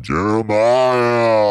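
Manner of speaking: loud, high, drawn-out yell of the name. Retold or imitated. Friend mimics the demon yelling his name. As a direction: bellow the name across the street. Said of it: "you started yelling".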